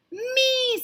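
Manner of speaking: eager students. Acted.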